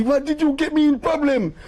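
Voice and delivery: high, worried complaint; fretful complaint. Retold or imitated. The guide panicking at Clive, high and fretful. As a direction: whine in panic.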